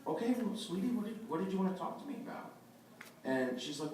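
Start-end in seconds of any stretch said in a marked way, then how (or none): 0.1-2.5 higher, gentle sweet-wife voice. Retold or imitated. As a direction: coax kindly like the sweetest person. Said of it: "my wife is just the sweetest person".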